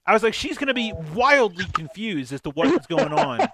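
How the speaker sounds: loud, high exasperated rant; hammed-up scolding of the boyfriend; retold rant.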